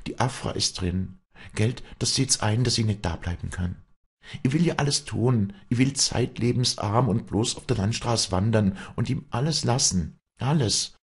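high, pleading dialect voice; distraught peasant woman holding back tears; Walli, anguished. Acted. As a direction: plead desperately with the priest, building in pitch. Walli pleads, near tears, with priest.

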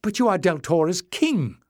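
high, emphatic protest; crying out. A narrator's reading. Jasmine protests to Leif, crying out. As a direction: exclaim in dismay, voice lifted. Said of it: "cried Jasmine".

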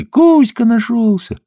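high, slow, joyful mermaid. Acted. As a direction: sing it out happily. (голос русалки)

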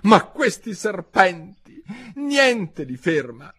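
high, slow, loud lament; high-pitched aggrieved bird; exasperated pigeon. A narrator's reading. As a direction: stretch out the grievance. Pigeon complaining about serpents.

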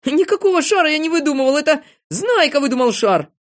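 high, hurried protest; flustered denial. A narrator's reading. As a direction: deny it urgently. Незнайка denies inventing the balloon.

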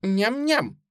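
contented yum-yum, a hint of a girl; little girl munching. A narrator's reading. Suzy munches on, unmoved.

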